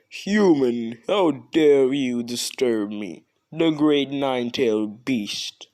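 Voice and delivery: hammed-up angry nine-tailed fox; outraged beast. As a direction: scold the tiny human like an ancient fox spirit. Fox beast angered at intruder.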